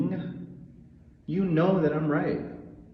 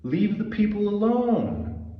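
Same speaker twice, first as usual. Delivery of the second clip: slow and higher, every word pressed; furious demand; 8 semitones higher than usual. Real, not acted. Telling Israel to stop, a slow high furious demand. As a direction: order them to stop.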